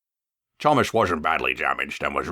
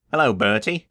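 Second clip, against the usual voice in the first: a bright, raised greeting across the road; called out; 6 semitones higher, 3 dB louder than usual. Acted. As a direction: call out cheerfully to Bertie. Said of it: "he called".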